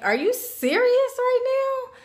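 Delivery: high-pitched, drawn-out disbelief; incredulous. Retold or imitated. Retold reply to being cornered.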